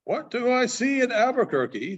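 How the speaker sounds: a hint of an excited frontier gossip bringing news; Baldy the mail rider.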